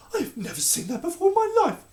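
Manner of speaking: high, loud indignant denial; loud protest. A narrator's reading. Queen accused of treason, protesting loudly. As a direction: fling the denial back hard. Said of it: "protested the Queen".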